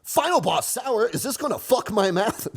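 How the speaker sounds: hammed-up product pitch; corny ad voice. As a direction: sell it like a cheesy commercial. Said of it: "do a Chamber Media ad".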